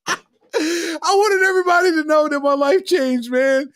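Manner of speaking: slow, laughing and half crying. Real, not acted. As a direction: stretch it out through helpless laughter. Laughing confession of the story.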